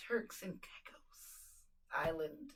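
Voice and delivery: slow hushed whisper. Real, not acted. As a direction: whisper it slowly.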